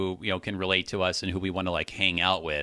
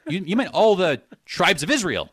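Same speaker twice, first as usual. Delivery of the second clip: high, loud mock disbelief; playing a shocked disciple; incredulous; 9 semitones higher, 7 dB louder than usual. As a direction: squeak the "what", then insist loudly.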